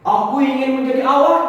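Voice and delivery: high, loud exclamation; seruan lantang. Acted. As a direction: call the wish out at full voice. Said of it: "seru Dharma". (berseru nyaring penuh tekad)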